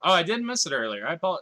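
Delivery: normal talking voice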